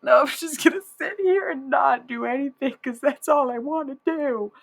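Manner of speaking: whiny Tyler Durden. Retold or imitated. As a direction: whine it like a lazy sulky kid. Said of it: "It's like".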